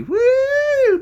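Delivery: loud, high party whoop. Acted. Streamer cheering American party, high whoop. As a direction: let out a long, squealed woo.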